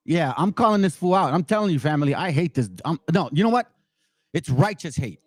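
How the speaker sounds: ordinary talk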